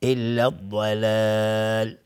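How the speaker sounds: slow chant stopping on الضلال with its long vowel held; stopping with long madd. Shown as a demonstration. Tajwid teacher showing students the lengthened vowel at a stop. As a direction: hold the alef of الضلال long before the stop.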